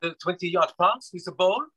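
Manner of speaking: a Frenchman's English, lightly mocked; mock French accent. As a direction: imitate a footballer doing a bad French accent in English. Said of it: "the worst attempt at a French accent".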